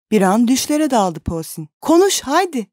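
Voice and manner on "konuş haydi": urging plea. A narrator's reading. Urging her to speak.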